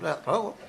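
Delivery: quiet "hasta luego" run into "talogo"; offhand "hasta luego", first syllable dropped; reduced form. Shown as a demonstration. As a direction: say "hasta luego" softly as "ta logo". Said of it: "que es lo que se dice".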